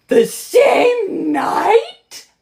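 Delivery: stretched, shrieking outrage; shocked shriek. Real, not acted. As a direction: wail it out in disbelief. Outraged at the book's event.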